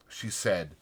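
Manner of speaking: usual speaking voice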